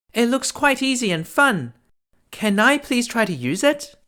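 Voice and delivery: put-on high, excited girlish voice; eager princess. A narrator's reading. The princess asks the old woman, high and eager. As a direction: plead eagerly to try. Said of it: "said the princess".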